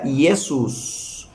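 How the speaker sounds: Jesús said slowly as "eh-soos"; Jesús as Latin Iesus; Latin pronunciation. Shown as a demonstration. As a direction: give Jesús a Latin sound "esus". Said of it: "en latín se pronuncia".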